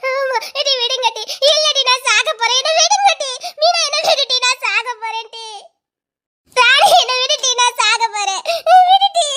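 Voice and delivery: tearful cries jumping up high; hysterical plea. Acted. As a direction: plead wildly to be let go. Struggles, threatens to die.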